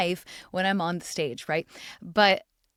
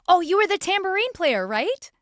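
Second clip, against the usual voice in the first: high and loud, mock-delighted fan voice; clueless fan; 9 semitones higher, 7 dB louder than usual. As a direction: play the fan's sudden eager recognition, pitched up. Fan recognizes her as tambourinist.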